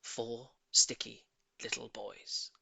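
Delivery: ordinary delivery, nothing marked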